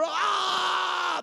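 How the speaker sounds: high, loud drawn-out "ah"; mock anointed wail; ecstatic cry. Elder under the anointing, crying out ecstatically.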